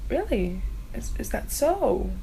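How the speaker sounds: sly. Retold or imitated. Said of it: "Sarah J Maas goes".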